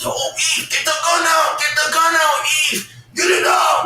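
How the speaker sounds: high, strained, urgent imitation; retold urgent undertone. Nervous Joe urging Eve to get the gun out, high strained mimicry. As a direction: mimic a nervous, squeezed plea for help. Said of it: "like, under his breath".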